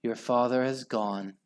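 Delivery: ordinary delivery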